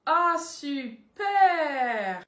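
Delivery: slow, strained, tearful super; fake joy. Friend hearing about a new guy, tearful fake joy. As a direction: force a happy word through tears.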